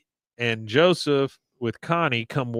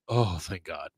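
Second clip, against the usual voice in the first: low, soft sigh of relief; relieved; 5 semitones lower, 7 dB quieter than usual. Retold or imitated. Bobby seeing his dad open the closet, quietly relieved. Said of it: "he's definitely relieved".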